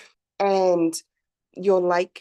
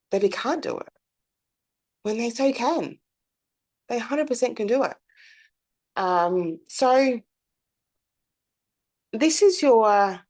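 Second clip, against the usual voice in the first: ordinary talk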